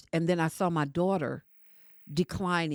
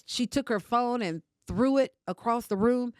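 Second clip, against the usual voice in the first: ordinary talk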